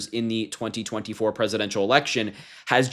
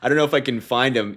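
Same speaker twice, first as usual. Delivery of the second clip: ordinary talk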